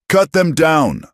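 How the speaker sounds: high, loud furious scream; screaming order. Acted. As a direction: scream at your men to shoot. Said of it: "Barlow was screaming".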